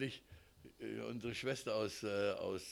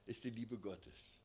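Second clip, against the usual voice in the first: usual speaking voice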